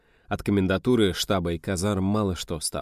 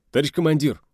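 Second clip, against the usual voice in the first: high, loud eager hail; urgent soldierly address; calling to a commander; 8 semitones higher, 6 dB louder, faster than usual. Acted. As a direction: call out to your commander. Soldier runs up to report.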